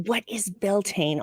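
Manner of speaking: hushed, fading to a whisper; slow, dropping to a breathless whisper. Pretend. Mocking people searching Beltane.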